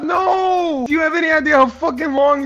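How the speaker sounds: real gaming rage; frustrated shout. Real, not acted. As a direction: blow up at the friend who wrecked it. Puzzle ruined by friend.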